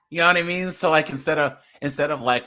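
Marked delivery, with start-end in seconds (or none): none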